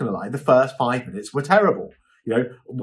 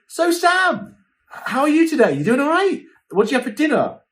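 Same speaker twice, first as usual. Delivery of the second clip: much higher, bright chatty host asking after Sam; chatty host banter; 12 semitones higher, 3 dB louder than usual. Retold or imitated. Host asks assistant Sam about his day, high chatty banter. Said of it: "then he'd be like".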